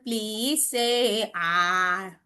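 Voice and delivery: low, very slow doctor asking; lightly voiced doctor. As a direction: gently instruct like a doctor. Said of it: "Let me look at your sore throat".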